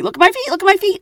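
a squeaky high booby strutting and showing off; chirpy high call of a bird showing off its feet; high mock bird call. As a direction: pitch it way up like a proud little bird. How the male birds say.

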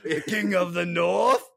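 high, loud, drawn-out mock proclamation; hammed-up fantasy acclamation; rallying cry. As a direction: bellow it like a fantasy war chant. Mock Game of Thrones rallying cry.

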